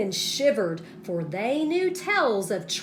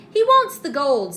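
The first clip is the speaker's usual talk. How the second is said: leprechaun; 5 semitones higher, 10 dB louder than usual. A narrator's reading. Leprechaun tells his men.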